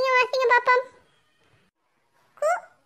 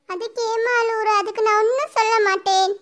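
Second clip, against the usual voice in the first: ordinary talk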